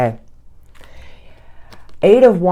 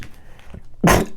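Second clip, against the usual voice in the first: stifled sneeze. Real, not acted. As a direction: cough while holding back a sneeze. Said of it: "I'm gonna sneeze".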